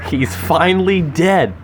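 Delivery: usual speaking voice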